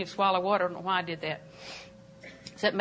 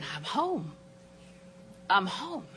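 tender relief; 9 dB quieter, much slower than usual. Pretend. Imagined waking up in heaven.

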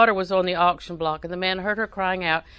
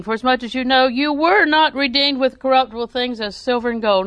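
louder, lifted voice for the verse; scripture reading; 4 semitones higher, 5 dB louder than usual. Acted. Preacher reading First Peter to the congregation, proclaiming.

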